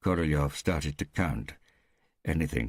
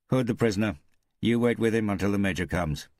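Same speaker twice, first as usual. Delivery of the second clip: guard's order; 5 semitones higher, 4 dB louder than usual. Acted. Guard gives orders to another.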